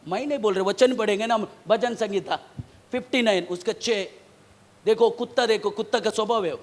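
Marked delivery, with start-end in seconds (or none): none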